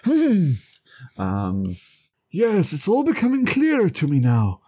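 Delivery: high, self-satisfied sleuth voice; mock detective. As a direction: play the pompous detective musing. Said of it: "puts a Sherlock hat on and is like".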